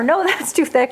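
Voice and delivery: high mock protest with a laugh; imagined viewers objecting. Viewers talking back to their screen, laughing mock protest. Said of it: "talking to your screen right now saying".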